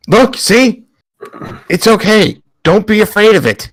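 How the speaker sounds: in-character reassurance. Acted. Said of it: "just be like".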